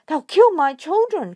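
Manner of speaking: high, loud terrified wail; frightened cry. Acted. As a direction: cry out for her children. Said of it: "cried Missus Fox".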